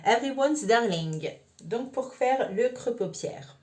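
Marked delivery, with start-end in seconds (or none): 0.0-1.3 English shade name in a French accent. Real, not acted. Vlogger reading out a lipstick-style shade name, French-accented English. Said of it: "pardonnez mon accent anglais".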